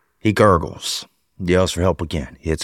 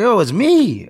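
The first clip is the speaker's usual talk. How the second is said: high friend voice; 12 semitones higher, 4 dB louder, slower than usual. Retold or imitated. Friend identifies himself.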